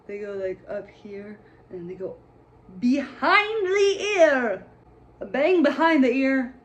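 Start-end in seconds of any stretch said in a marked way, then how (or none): 2.8-4.7 stretched out, much higher and louder, slow; incredulous. Real, not acted. Mocking the movie's bangs. 5.2-6.5 repeated higher and louder, stress on bang; emphatic disbelief. Real, not acted.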